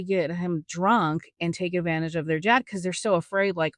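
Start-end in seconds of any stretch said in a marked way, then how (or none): none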